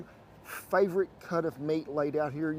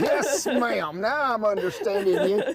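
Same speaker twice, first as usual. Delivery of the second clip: laughing; 4 semitones higher, 6 dB louder, slower than usual. Real, not acted. Delighted reaction to her twang.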